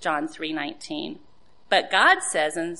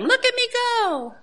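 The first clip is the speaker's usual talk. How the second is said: boastful shout. Acted. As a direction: shout it like a gloating racer. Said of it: "shouting at them".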